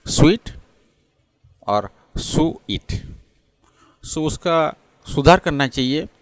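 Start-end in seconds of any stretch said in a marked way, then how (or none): 0.1-0.5 स्वीट as loosely said in India, "su-it"; Indian mispronunciation. Shown as a demonstration. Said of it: "गलत से India में बोलते हैं". 2.2-2.9 सुईट slowly split as "su-it"; सुईट with u in place of the w sound; Indian mispronunciation. Shown as a demonstration. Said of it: "गलत से India में बोलते हैं".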